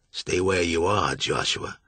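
low, menacing beast voice; lightly voiced cold monster. A narrator's reading. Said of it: "said the beast".

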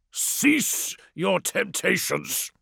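slow, high, hissing warning; hissed. A narrator's reading. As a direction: hiss the words slowly. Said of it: "hissed Tefra".

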